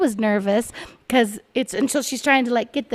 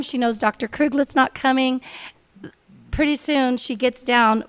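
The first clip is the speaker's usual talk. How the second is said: ordinary talk, nothing marked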